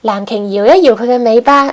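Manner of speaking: plain reading voice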